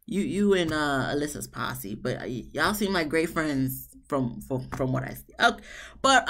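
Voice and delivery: normal talking voice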